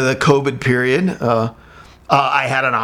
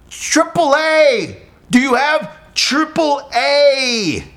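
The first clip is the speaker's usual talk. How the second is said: slow; 11 semitones higher, much slower than usual. Retold or imitated. Repeating slowly to foreigner.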